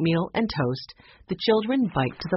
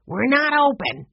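mildly gruff, raised-pitch dismissal; 9 semitones higher, 4 dB louder than usual. A narrator's reading. Ride operator to early visitors, lightly gruff and curt. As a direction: turn them away gruffly. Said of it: "he said gruffly".

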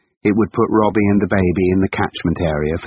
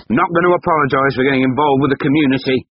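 defensive retort; 8 semitones higher, 4 dB louder, faster than usual. Acted. Husband defensive.

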